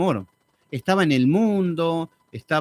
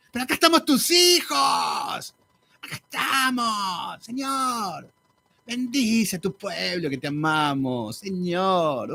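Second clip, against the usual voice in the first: mocking pleading worshippers; slower than usual. Mocking people's pleading prayer.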